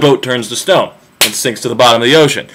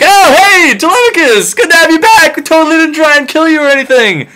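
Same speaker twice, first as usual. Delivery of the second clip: much higher, louder sing-song mock friendliness; fake cheery welcome; 4 dB louder than usual. Suitors greeting Telemachus, high loud fake-cheery gush. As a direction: gush with obvious fake innocence.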